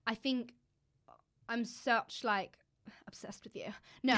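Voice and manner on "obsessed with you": unvoiced whispered aside. Real, not acted. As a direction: whisper it as a secret.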